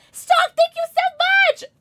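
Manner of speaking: loud, high excited shout; high-pitched gleeful yell; shouted thanks. Real, not acted. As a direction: shriek the thanks, drawn out.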